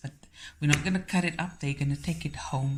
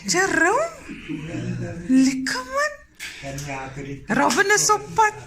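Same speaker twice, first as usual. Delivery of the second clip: much higher, loud, shouting joyful welcomes; excited greetings; 8 semitones higher, 6 dB louder than usual. Real, not acted. Greeting arriving guests loudly.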